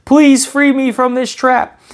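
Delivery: high, slow pleading squirrel voice. A narrator's reading. Trapped squirrel begs the passing hero for help, high and pleading. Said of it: "springs to life and pleads".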